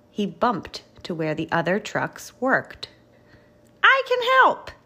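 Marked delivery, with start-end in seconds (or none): 3.8-4.7 high, loud little bulldozer outburst; eager exclamation. A narrator's reading. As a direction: pipe up keenly, pitched way up. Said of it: "he exclaimed".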